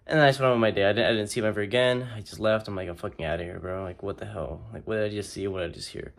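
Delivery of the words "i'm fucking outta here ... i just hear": softer, low muttered disbelief. Retold or imitated. Leaving the water park ride, muttering in disbelief. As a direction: grumble it to yourself while leaving. Retold inner reaction.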